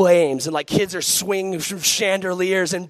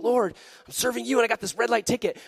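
mad complaint; 5 semitones higher, faster than usual. Retold or imitated. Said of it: "I was so mad at that".

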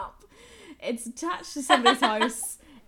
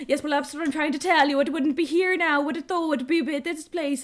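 imitating clerk; 6 semitones higher, 6 dB louder than usual. Post office clerk voiced.

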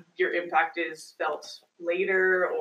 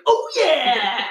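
big, loud booming catchphrase shout; Kool-Aid Man; 4 semitones higher, 7 dB louder than usual. Acted. Host mimicking the drink mascot for a laugh, Kool-Aid Man. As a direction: burst out with a mascot's hearty yell. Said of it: "be like".

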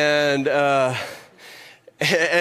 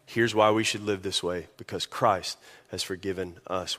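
ordinary talk

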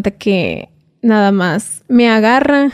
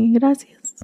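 breathy whispered gracias, barely audible; whispered thanks; 4 semitones higher, 4 dB quieter than usual. Retold or imitated. Young woman whispering thanks to the cartel boss. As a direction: whisper it, scared to speak up.

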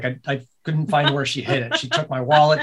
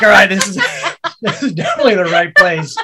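louder, laughing through the words; amused, laughing aside; laughing quote; 5 semitones higher, 9 dB louder than usual. Retold or imitated. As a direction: laugh while saying it. Laughing at divorce court.

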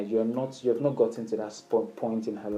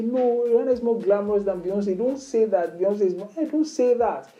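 higher playful mock warning; casual flirty banter demo; playful teasing; 7 semitones higher than usual. Shown as a demonstration. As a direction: threaten lightly with a grin in the voice. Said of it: "Say it so casually".

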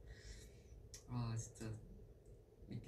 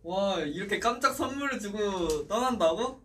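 surprised; 7 semitones higher, 9 dB louder than usual. Real, not acted. Surprised members leaving after gift.

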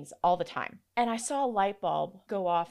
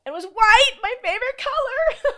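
thrilled squeal; 10 dB louder than usual. Real, not acted. Thrilled at finding convertible.

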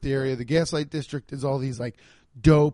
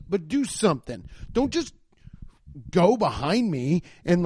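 imagined angry rant; 7 semitones higher than usual. Pretend. To the cyclist who trailed him, a high exasperated rant. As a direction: let him have it, pitched way up. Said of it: "I wanted to say".

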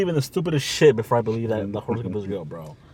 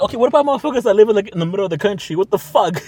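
exasperated rant; 7 semitones higher, 6 dB louder, faster than usual. Real, not acted. Exasperated rant about crystals.

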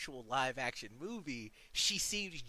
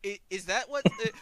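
alarmed disbelief; 8 semitones higher, 13 dB louder than usual. Retold or imitated. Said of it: "I was like".

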